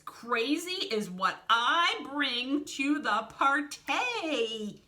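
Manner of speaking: loud, high, drawn-out showman delivery; cocky Piranha boast. A narrator's reading. Piranha boasts to Wolf before a daring jump, cocky. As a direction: stretch the last word out big and loud.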